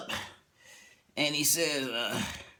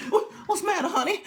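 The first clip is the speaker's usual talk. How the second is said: high, startled wife voice; husband's fuss draws a high worried reply; 14 semitones higher, faster than usual. Retold or imitated. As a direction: play the wife, alarmed and high.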